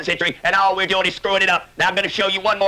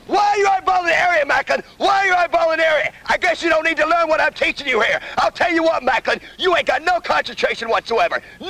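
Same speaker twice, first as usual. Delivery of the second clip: drill instructor yelling; 8 semitones higher than usual. Acted.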